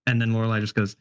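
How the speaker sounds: usual speaking voice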